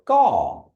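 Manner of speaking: gaw said high and loud as a model; teacher models Kiowa gaw. Shown as a demonstration. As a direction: model gaw clearly for the class. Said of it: "There you go".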